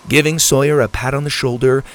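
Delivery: plain reading voice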